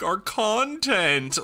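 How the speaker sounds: high, slow whine; whiny mock complaint. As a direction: whine it like a spoiled kid. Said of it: "We're like".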